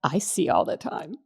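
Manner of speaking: softly spoken, measured rebuke; kindly but firm. Retold or imitated. Holy Spirit reminding a worried woman, softly firm. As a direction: say it quietly, as a gentle correction. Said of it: "very kindly but firmly saying".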